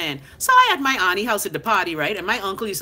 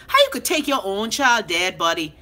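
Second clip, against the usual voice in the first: ordinary delivery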